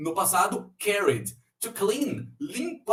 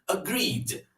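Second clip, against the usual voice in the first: usual speaking voice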